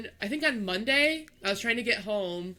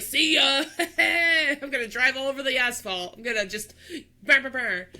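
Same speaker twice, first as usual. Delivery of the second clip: mock reckless driver; 5 semitones higher, 5 dB louder than usual. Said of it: "it's not like I was like".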